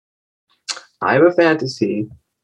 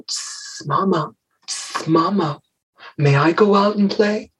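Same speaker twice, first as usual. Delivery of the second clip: put-on higher, softer snake child with long hisses; hissing little snake; 6 semitones higher, 3 dB quieter, slower than usual. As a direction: hiss between the words like a pleading little snake.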